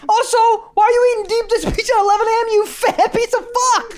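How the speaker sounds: high snide voice, laughing; mocking voice. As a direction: whine it out with a sneer. Mocking impression of commenter.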